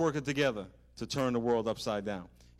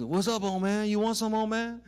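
high, loud mocking taunt from a big tough guy; big dude taunting; 11 semitones higher, 6 dB louder than usual. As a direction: yell up at the old man like a pushy bully. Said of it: "And the big dude's".

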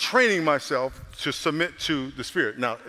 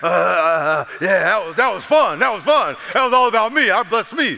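satisfied; 4 semitones higher, 7 dB louder than usual. Retold or imitated. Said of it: "And I go to bed".

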